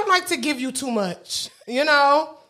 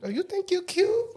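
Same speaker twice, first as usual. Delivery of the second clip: teasing put-down; 6 semitones higher, 7 dB quieter than usual. Retold or imitated. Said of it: "I be like".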